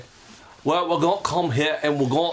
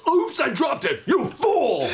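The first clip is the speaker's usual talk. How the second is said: higher shouted villain, breaking into a laugh; Shredder; 8 semitones higher, slower than usual. Acted. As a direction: snap at the clumsy henchman. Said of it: "Shredder talking to Bebop".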